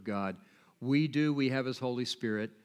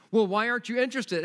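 pushy salesman; 10 semitones higher, 7 dB louder, faster than usual. Retold or imitated. Pushy salesman voiced in story.

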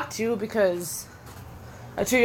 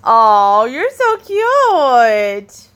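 gushing coo; 6 dB louder, much slower than usual. Real, not acted. Babysitter adoring the two-year-old, long gushing coo. Cooing at the toddler.

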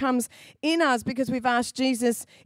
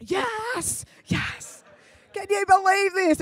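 secret glee; 7 semitones higher, slower than usual. Retold or imitated. Secret delight at the room.

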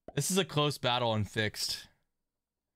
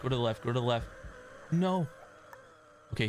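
ordinary talk, nothing marked

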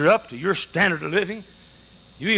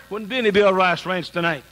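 normal talking voice

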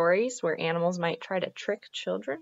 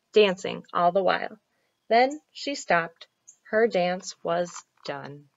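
lively, lilting storytelling; story narration; 4 semitones higher, 7 dB louder, slower than usual. A narrator's reading. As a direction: tell it vividly for young listeners. Reads a children's story aloud.

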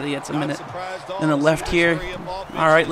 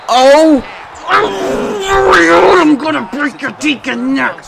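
loud, high, drawn-out strained yell; exasperated wailing shout; raging; 7 semitones higher, 5 dB louder, much slower than usual. Real, not acted. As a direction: howl the threat slowly at high pitch. Rages at the game.

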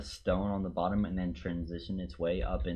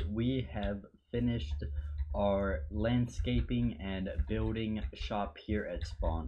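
ordinary talk, nothing marked

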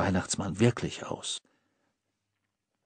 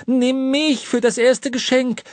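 much higher, loud demanding whine; pestering child; 13 semitones higher, 7 dB louder than usual. A narrator's reading. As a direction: demand it like a spoilt brat. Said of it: "verlangte er".

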